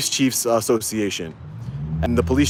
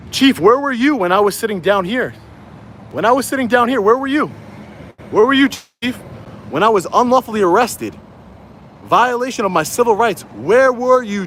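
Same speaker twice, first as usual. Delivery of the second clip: high-pitched, insistent demanding; pleading outrage, pitched well up; indignant confrontation; 8 semitones higher than usual. Real, not acted. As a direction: call out the chief with accusing questions. Confronts absent chief, rhetorical questions.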